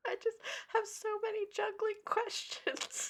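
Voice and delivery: high, soft squeak with laughter; tiny plaintive voice. Acted. Baffled fan to tight-lipped actor, tiny plaintive voice. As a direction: squeeze it out small and high, laughing.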